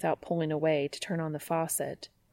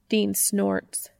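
plain reading voice

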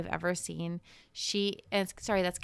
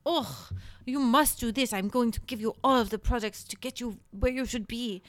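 higher-pitched mock French accent; hammed-up French accent, scolding; 4 semitones higher than usual. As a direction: imitate a disgusted Frenchman lecturing a client. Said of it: "He's, he's French".

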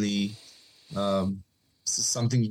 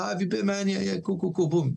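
higher-pitched, chatty retold greeting; breezy small talk, voice lifted high; friendly neighbour; 8 semitones higher than usual.

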